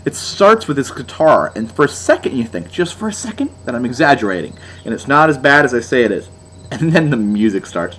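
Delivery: usual speaking voice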